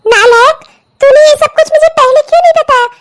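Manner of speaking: loud, high angry scolding of her son. Acted. Mother scolding her son for hiding the marriage, angry and loud.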